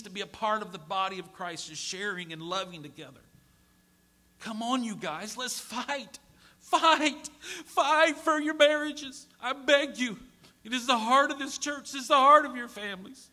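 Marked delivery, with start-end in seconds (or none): 4.4-13.3 high, loud impassioned plea; preaching at full force. Acted. Pastor begging congregation to fight for marriages, impassioned preaching. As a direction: beg the church to fight. Climactic appeal.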